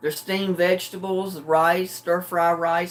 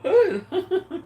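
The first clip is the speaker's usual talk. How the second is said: laughing; 12 semitones higher than usual. Real, not acted. Laughs at own money joke.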